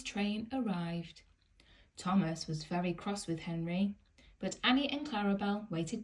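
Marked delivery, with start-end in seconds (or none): none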